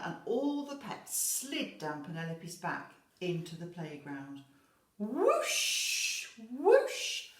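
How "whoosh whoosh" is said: loud whoosh swooping upward; high, excited sliding noise. A narrator's reading. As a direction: swoop up high like a slide ride. Sliding sound effect in story.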